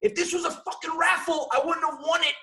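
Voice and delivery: high-pitched ranting shout; retold yelling. Man in the basement cursing his luck with a new computer, exasperated yelling. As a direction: rant loudly in exasperation. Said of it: "my wife starts hearing yelling".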